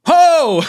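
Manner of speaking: loud high "Ho!"; surprised shout. Real, not acted. Reacts to big donation message.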